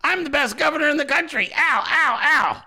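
high whiny ow ow, helpless; mock whimper. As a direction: play the bullied governor crying out. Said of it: "he's just like".

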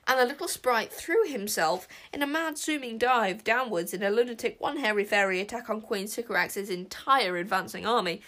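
ordinary delivery, nothing marked

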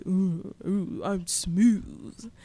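lightly voiced wannabe smooth guy; a hint of a cocky ladies' man; mock smooth guy. Mocking a cocky classmate, lightly voiced smooth guy. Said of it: "he is a wannabe smooth, debonair guy".

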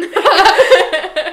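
sudden high shrieking laugh; loud burst of laughter. Real, not acted. Laughing at the captain joke, loud laugh. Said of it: "You've killed her".

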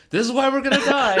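"This is why we're gonna die" shrieked high and loud; hammed-up drunk friend yelling about recycling; mock shriek. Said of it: "and you're like".

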